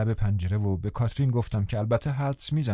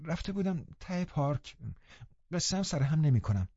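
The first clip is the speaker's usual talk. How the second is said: put-on higher, softer young girl, faltering; guilty young lady caught out, hesitating; hesitant girl; 6 semitones higher, 6 dB quieter than usual. A narrator's reading. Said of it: "با من و من گفت".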